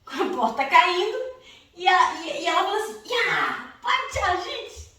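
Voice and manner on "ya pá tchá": kung fu yell. Retold or imitated. Mom breaking down the door.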